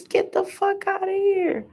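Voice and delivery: high, soft, incredulous. Real, not acted. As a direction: squeak it out in disbelief. Stunned reaction.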